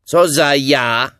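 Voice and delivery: high, loud, drawn-out query; high, slow holler; shouted question. A narrator's reading. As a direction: call back demanding who it is. Rabbit shouts back, puzzled.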